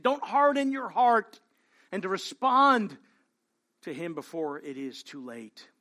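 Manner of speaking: ordinary talk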